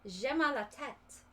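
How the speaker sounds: English speaker's French, lightly done; French attempt. Shown as a demonstration. An English speaker showing off her little French. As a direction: try out a bit of schoolbook French. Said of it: "I know".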